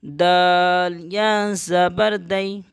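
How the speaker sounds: slow letter-by-letter spelling; teacher spells out نا slowly. Shown as a demonstration. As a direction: spell نون زبر نا slowly. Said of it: "یا لین کو جلدی پڑھتے ہیں اس طرح سے".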